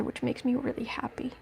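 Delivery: whispered aside; whispered happy aside to the chat. Real, not acted. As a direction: breathe it out as a soft whisper. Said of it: "unvoiced whisper".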